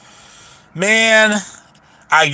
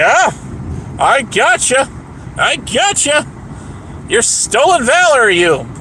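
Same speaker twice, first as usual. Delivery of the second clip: mocking taunt; 8 semitones higher, slower than usual.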